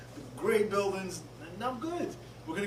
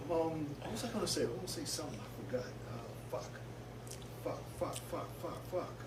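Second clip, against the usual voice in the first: muttering to himself; 12 dB quieter than usual. Real, not acted. Forgot what he was saying.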